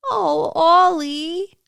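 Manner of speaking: drawn-out fond dismay; sympathetic cry. Acted. Sister comforting little Oliver, slow high sympathetic cry. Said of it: "his sister cried".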